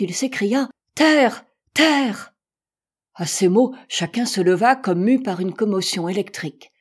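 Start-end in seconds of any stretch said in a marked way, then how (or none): 0.9-2.3 high, loud cry of land sighted; ringing shout. A narrator's reading. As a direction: shout it out to the whole boat. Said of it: "d'une voix éclatante". (cri éclatant, fort et aigu)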